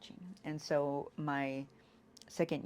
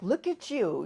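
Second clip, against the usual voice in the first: higher, louder yelled retort; son yelling; 7 semitones higher, 9 dB louder, slower than usual. Retold or imitated. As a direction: yell it back at your mother. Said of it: "he's yelling at me like".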